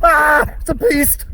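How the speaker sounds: high, loud startled cry; excited shout. Real, not acted. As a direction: yelp it out in real surprise. Spotting a big animal on trail.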